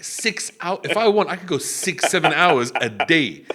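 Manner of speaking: high, loud, drawn-out brag; comic boasting. Retold or imitated. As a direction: brag proudly, stretching out the hours. Retold oblivious boasting about stamina.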